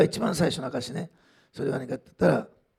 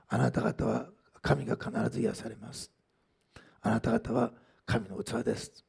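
slow, lower, softer prophetic delivery; solemn prophecy; 6 semitones lower, 5 dB quieter, slower than usual. Retold or imitated. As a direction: quote a prophecy slowly and deliberately, voice lowered. Quotes a pastor's solemn prophecy.

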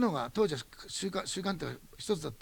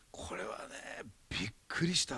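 hushed confiding whisper; soft whispered aside; 6 semitones lower, 6 dB quieter, slower than usual. Real, not acted. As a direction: lean in and breathe it secretly. Excited aside about the tuition cut.

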